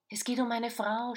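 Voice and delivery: husband shouting, frantic about his missing wife. A narrator's reading. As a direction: yell it out at the inspector. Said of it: "schreit Lorenz".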